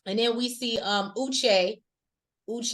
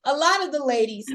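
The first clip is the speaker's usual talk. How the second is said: laughing; 6 semitones higher, 4 dB louder than usual. Real, not acted. Laughing through the words.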